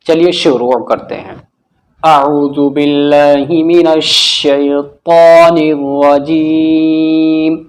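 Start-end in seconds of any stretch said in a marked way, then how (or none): 2.0-7.6 slow chanted recitation, long-held final syllables; Arabic recitation. Real, not acted. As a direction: intone it with drawn-out vowels. Recites opening invocation. (धीमी, खींची हुई तिलावत)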